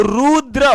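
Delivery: रुद्र stretched out, final अ sounded; drawn-out final vowel. Shown as a demonstration. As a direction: sound the अ at the end of रुद्र clearly. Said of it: "last में अ आ रहा है".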